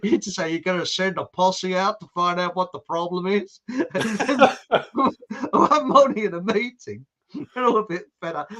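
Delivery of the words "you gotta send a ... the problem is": lightly imitated Texan accent. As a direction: mimic a Texan colleague giving orders. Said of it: "a bloke from Texas, an American".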